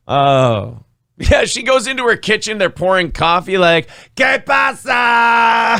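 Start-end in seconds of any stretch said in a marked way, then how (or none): 4.2-5.8 slow drawn-out shout; shouted greeting. Pretend.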